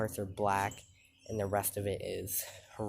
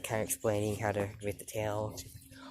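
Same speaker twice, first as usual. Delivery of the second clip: normal talking voice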